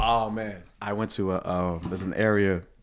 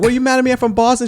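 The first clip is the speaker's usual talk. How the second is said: high loud mock protest; 6 dB louder, faster than usual. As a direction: pitch jumps high and loud, playful indignation. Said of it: "my friend's like".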